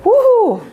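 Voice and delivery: high, playful "woo-hoo"; high-pitched whoop. Real, not acted. Teacher reacting to a student's answer, high whoop. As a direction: let out a rising-then-falling whoop.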